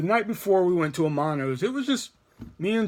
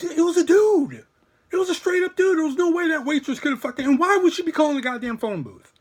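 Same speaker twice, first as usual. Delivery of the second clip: high-pitched, insistent arguing voice; retold incredulous protest; 10 semitones higher than usual. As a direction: argue back in high, worked-up disbelief. Retold argument with his friend.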